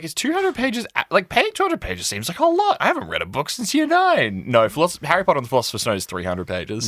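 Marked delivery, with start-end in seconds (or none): 0.0-4.4 mocking high voice. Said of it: "I was like".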